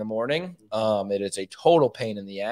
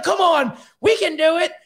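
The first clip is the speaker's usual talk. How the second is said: high shouted urging; comic high-pitched rallying cry; cheerleading priest; 4 dB louder than usual. Retold or imitated. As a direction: imitate an over-eager helper shouting encouragement. Priest acted urging him on.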